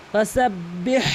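slow ফাসাব্বিহ ending in a strong throaty h; heavy throat h. Shown as a demonstration. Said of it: "এটি বলতে হবে".

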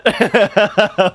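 high, giddy laugh; high delighted laugh. Real, not acted. Laughing after the win.

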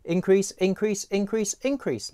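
four increases pitched high. Shown as a demonstration. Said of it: "I could increase that further".

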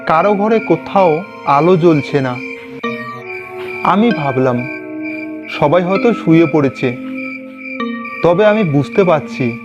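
usual speaking voice

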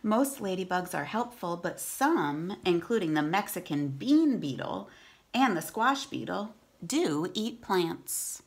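clear, steady read-aloud voice; storybook reading for kids; reading to children. Acted.

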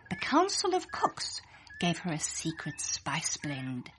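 plain reading voice

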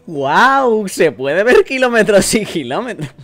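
high, loud acted amazement; excited character. Rereading the character's line about seeing kilometres, excited. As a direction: exclaim it big, hammed-up wonder.

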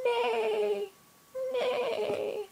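high whinnying neigh; horse neigh. Retold or imitated. Parent to baby, high horse neigh. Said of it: "Horse says".

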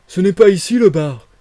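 loud, high, hoarse sailor asking for the bar; a gruff sailor barging in, calling out. Acted. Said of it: "la voix rauque de sa première question". (voix rauque de matelot, forte et haute)

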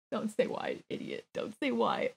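very soft, under the breath; muttered self-scolding. Real, not acted. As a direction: scold yourself almost inaudibly.

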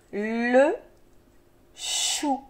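"Le chou" drawn out, long l and long ch; syllable by syllable. Shown as a demonstration. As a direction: hold "Le", pause, then slide slowly into "chou". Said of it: "des syllabes qui se donnent la main".

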